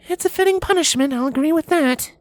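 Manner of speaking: high mare voice, reluctant agreement; grudging Cadance. A narrator's reading. Cadance grudgingly agreeing with her husband, high voice. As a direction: give in unwillingly, up high. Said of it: "admitted Cadance grudgingly".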